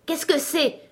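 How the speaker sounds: a startled giant shouting out of sleep; startled cry. Acted. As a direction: jolt awake and cry out. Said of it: "cria-t-il".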